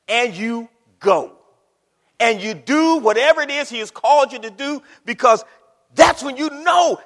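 emphatic, rising pulpit exhortation; full-force preaching. Real, not acted. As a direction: build it toward a shout.

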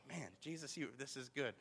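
very soft private aside; hushed gratitude. Retold or imitated. Dad thanks Jesus for empty houses, hushed gratitude. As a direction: murmur it to Jesus under your breath.

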